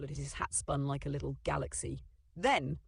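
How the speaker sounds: ordinary delivery, nothing marked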